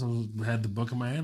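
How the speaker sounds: ordinary delivery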